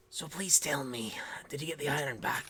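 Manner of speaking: unvoiced, whispery dwarf voice; Hunra. Female dwarf asking Leati about the iron, whispery. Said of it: "Hunra looks at you".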